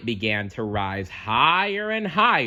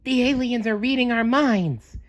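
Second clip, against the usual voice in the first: much higher, urgent sponge warning; warning SpongeBob; 13 semitones higher, 4 dB louder than usual. A narrator's reading. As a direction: warn your friend about mind control. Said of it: "he warned Patrick".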